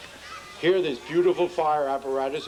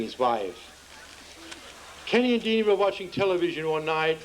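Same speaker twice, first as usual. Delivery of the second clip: ordinary talk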